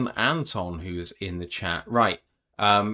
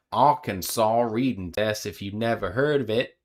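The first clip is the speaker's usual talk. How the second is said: put-on Southern accent. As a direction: read it like a good ol' Arkansas boy. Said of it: "apparently I needed to read with the Southern accent".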